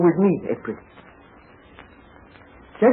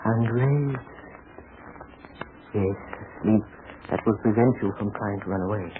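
hypnotic voice; 6 semitones lower, 9 dB quieter than usual. Acted. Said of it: "she's hypnotizing the other gal".

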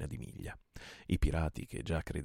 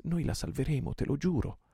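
higher, fervent promise; vow; 8 semitones higher, 3 dB louder than usual. A narrator's reading. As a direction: swear it to his brother. Janez vows to his friend.

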